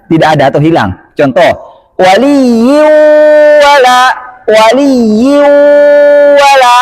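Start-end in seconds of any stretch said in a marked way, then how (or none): 2.0-6.8 waliyyu wala with a full nasal hum, higher; full ghunnah. Shown as a demonstration. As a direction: hum fully through the nose on waliyyu wala. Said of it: "dengungnya sempurna".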